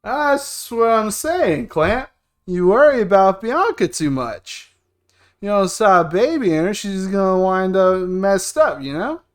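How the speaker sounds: loud, emphatic drinking-buddy voice; drinking buddy, opinionated. Acted. Friend warning Clamp about his sister, loud and opinionated. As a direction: lecture him loudly over drinks.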